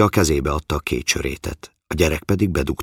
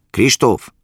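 raised, higher-pitched call; 8 semitones higher, 6 dB louder than usual. A narrator's reading. Father shouts for his son, calling out loud and high. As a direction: shout out the name to summon him. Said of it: "kiáltotta el magát".

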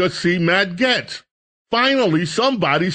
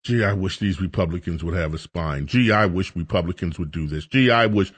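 low, soft, mopey sigh of a let-down voter; mock lament; 9 semitones lower, 3 dB quieter than usual. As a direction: moan it like a defeated voter. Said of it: "Republicans shake their head".

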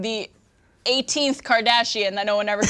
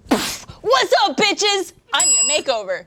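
sassy Mona Lisa; 6 semitones higher than usual. Acted. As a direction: shout it like a cheeky diva. Mona Lisa comes to life.

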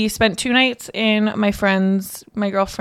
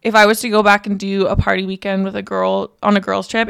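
ordinary talk

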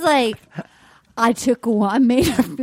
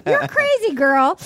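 put-on high girly voice; 6 semitones higher, faster than usual.

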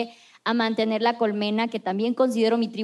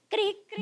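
cricket chirps; 10 semitones higher, 7 dB quieter than usual. Pretend. Joking about awkward silence.

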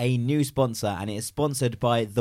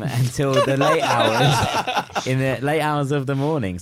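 laughing through the words; giggling, drawn out slowly; 5 semitones higher, 8 dB louder, slower than usual. Real, not acted. Laughing through pub plug.

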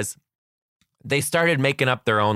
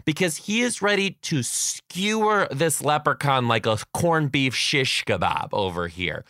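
ordinary talk

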